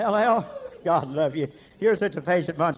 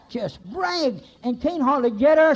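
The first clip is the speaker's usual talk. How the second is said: excited preaching; 8 semitones higher, 6 dB louder, slower than usual. Real, not acted. Excited preaching about wife.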